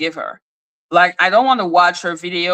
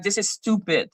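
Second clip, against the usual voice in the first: softer, matter-of-fact verdict; serious; 8 dB quieter than usual. Real, not acted. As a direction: say it seriously, dropping the volume. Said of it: "which is serious".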